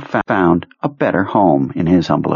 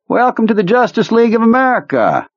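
put-on much higher, theatrical old commander; high eccentric officer voice. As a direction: play the odd colonel, high and grand. Kurtz's high character voice.

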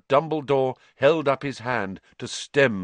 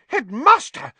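much higher, loud spluttered protest; spluttering matron; 8 dB louder than usual. Acted. Nurse protesting to the headmaster, spluttering high and loud. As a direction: splutter in outrage. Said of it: "spluttered Madam Pumphrey".

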